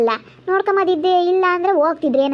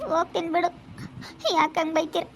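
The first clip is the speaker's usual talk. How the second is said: much softer, hurt; tearful; 7 dB quieter than usual. Acted. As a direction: say it quietly through tears. Hurt relative leaving, tearful. (ನೋವಿನಿಂದ ಮೆಲ್ಲಗೆ)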